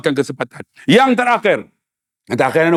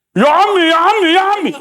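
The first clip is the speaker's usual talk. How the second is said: calling out to uncle; 9 semitones higher than usual. Retold or imitated.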